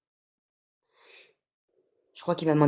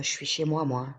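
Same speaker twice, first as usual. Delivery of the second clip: ordinary talk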